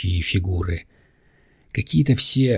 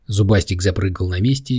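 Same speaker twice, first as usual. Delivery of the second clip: ordinary delivery, nothing marked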